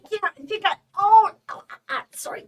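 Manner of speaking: pained little gasps, a pretended hurt ankle; feigned pain. Woman fakes a fall to the stranger, acting hurt. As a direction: put on an injured yelp, then ask for help.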